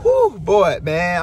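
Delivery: normal talking voice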